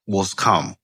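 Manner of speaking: ordinary delivery, nothing marked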